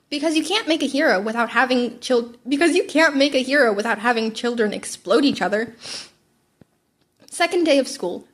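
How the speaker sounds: usual speaking voice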